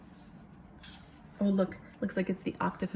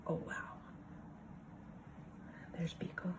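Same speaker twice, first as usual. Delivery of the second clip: hushed whisper of discovery; 15 dB quieter, faster than usual. Acted. Teacher pointing out Beagle to the children, whispering. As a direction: whisper it like a secret find. Spotting the dog in the picture.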